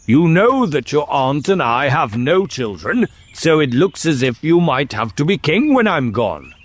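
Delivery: high-pitched, patronizing royal uncle; tiresome grown-up condescension. Acted. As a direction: humour the child without really caring. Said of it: "talking in the tiresome way".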